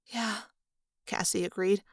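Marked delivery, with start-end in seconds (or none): 0.1-0.4 soft, subdued yes; small, sorrowful murmur; sad agreement. A narrator's reading. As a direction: agree quietly through a sad smile. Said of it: "smiling a deeply sad smile".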